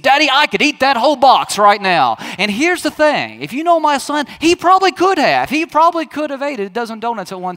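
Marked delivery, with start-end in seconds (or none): 0.0-2.2 put-on boastful child. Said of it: "he looked at me, he said".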